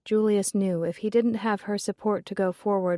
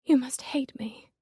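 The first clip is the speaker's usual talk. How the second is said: breathless, unvoiced whisper; a hurt young woman whispering her guilt; voiceless, pleading whisper; 5 semitones higher than usual. A narrator's reading. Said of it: "she whispered".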